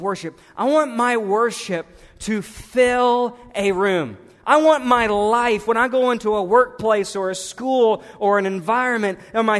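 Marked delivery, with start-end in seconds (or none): none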